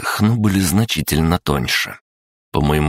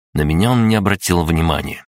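ordinary delivery

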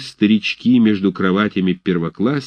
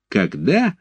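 cocky mocking echo of the question; feisty echo; 6 semitones higher than usual. A narrator's reading. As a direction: throw the question back defiantly. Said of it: "с задором переспрашивает Федченко".

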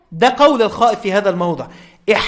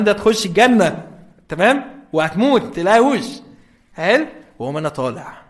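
normal talking voice

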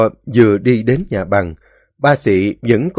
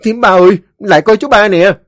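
high, loud cry for help; calling out; 10 semitones higher, 8 dB louder, faster than usual. A narrator's reading. Tùng calls the aunt to come stop Ba Xị, crying out. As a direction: shout urgently for someone to come. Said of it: "la lên".

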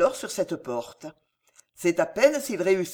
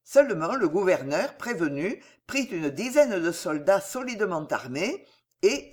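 ordinary delivery

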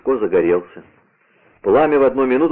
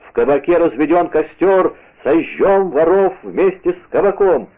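higher, raised mob cry; roaring crowd; 7 semitones higher, 3 dB louder than usual. A narrator's reading. Crowd vowing to burn thieves with tavern, raised roar. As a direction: rouse the mob in a contained shout. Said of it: "ревела толпа".

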